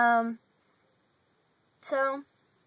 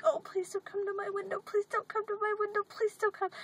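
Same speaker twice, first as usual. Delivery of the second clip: higher, softer pleading under the breath; a hushed, higher please-don't-come plea; mock pleading dread; 9 semitones higher, 7 dB quieter than usual. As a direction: beg quietly that he passes by. Dreading the preacher at her window.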